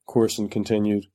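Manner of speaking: usual speaking voice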